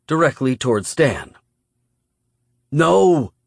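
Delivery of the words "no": high, loud cry of "No"; alarmed shout. A narrator's reading. Hero tries to stop an attacker, alarmed shout. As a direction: shout out in protest. Hero cries out as victim is threatened.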